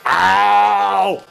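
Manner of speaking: sharp cry of pain; pained yelp. Real, not acted. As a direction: yelp from the cuff. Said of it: "It's 'cause you scream".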